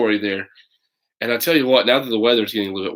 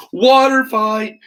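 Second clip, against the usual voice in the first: gleeful cry; 12 semitones higher, 6 dB louder, much slower than usual. Acted. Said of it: "Junior Boy laughs".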